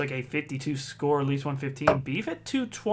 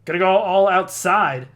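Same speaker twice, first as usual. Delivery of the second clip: loud, raised commentary voice; punchy sportscaster shout; excited live call; 5 semitones higher, 9 dB louder than usual. Real, not acted. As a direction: call the miss out, higher and louder.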